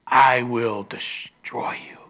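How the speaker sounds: slow threatening whisper; an angry client whispers a threat; menacing whisper. Retold or imitated. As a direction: hiss the threat low and slow. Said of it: "the guy got mad, angry, said".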